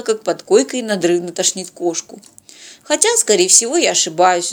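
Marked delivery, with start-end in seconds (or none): none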